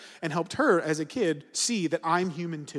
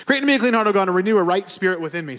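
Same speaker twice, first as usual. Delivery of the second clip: scripture reading; 3 semitones higher, 7 dB louder, faster than usual. Shown as a demonstration. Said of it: "See, it's coming to life a little bit".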